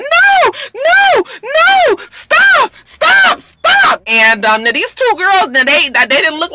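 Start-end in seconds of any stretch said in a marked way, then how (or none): 0.0-4.0 very high, loud panicked screams; imitating kids screaming at a fight. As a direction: scream for them to stop like a scared kid. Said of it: "They kept on going".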